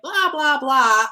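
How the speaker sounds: hammed-up blah-blah heckling; nagging inner committee. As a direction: imitate noisy inner critics yammering. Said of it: "my itty bitty shitty committee".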